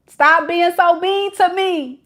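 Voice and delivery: high whiny plea; pleading boyfriend. Retold or imitated. Reader voicing the hurt boyfriend whining to Shay, high. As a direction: whine at her to stop.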